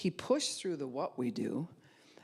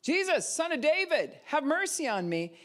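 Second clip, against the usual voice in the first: a much higher, louder plea; crying out; 12 semitones higher, 4 dB louder than usual. Acted. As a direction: beggar calls to Jesus, pleading shout. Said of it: "You're not going to just go".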